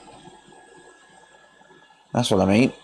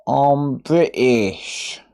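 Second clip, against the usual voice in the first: drawn out; much slower than usual. Shown as a demonstration. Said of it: "I'm English".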